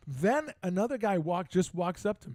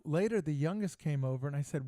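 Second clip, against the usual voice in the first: ordinary talk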